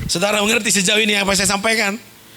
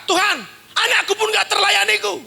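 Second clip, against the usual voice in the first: complaining shout; 9 semitones higher than usual. Retold or imitated. Impersonating a complaining believer.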